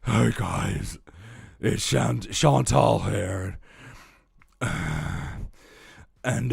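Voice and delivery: slow, laboured fat-person voice with heavy sighs. Acted. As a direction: drag it out slowly, puffing between phrases. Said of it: "so a fat voice is".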